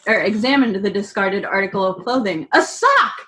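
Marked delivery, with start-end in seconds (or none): 2.5-3.1 high, squeaky elf cry, much higher; Dobby exclaiming. A narrator's reading. Dobby finding the sock, exclaiming high. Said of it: "exclaimed Dobby".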